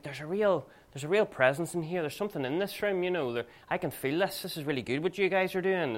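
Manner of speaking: tipsy enthusiasm. Retold or imitated. Drunk visitor telling the preacher he feels a presence, mildly imitated enthusiasm. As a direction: mildly imitate an impressed drinker, pitch lifted. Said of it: "These guys have had a few drinks".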